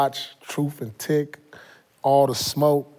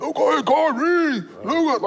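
mocking cohost's voice; 9 semitones higher than usual. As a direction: mimic him whining about Luka, pitched way up. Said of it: "I'ma do your voice like you try to do mine".

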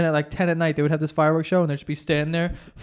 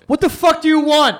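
loud, high, angry snap; annoyed kid snapping at his calling friends, loud and high; snapping back; 9 semitones higher, 5 dB louder than usual. Retold or imitated. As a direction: whip around and bark at them. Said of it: "decided to turn around and go".

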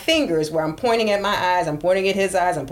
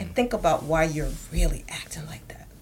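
whisper; 2 semitones lower, 4 dB quieter than usual. Real, not acted. Whispered aside on reflection.

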